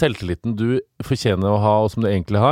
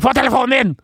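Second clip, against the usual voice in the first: imitating boss; 12 semitones higher, 4 dB louder, much faster than usual.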